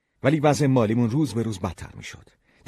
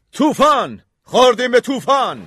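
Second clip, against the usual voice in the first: high, very loud cry of alarm; captain's alarmed shout; 10 dB louder than usual. A narrator's reading. Captain shouting to everyone that a storm has hit, alarmed shout. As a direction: shout a warning across the ship. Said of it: "ناخدا داد زد".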